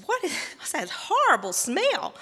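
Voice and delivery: loud, high, appalled question; disgusted. Retold or imitated. Sister sniffing something awful by the stove, disgusted. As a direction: recoil from a stink, acted out.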